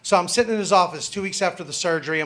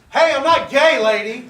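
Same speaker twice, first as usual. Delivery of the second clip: indignant protest; 6 semitones higher, 4 dB louder than usual. Retold or imitated. Protests to nurse after tube out.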